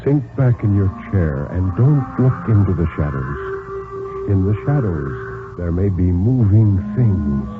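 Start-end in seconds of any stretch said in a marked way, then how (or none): none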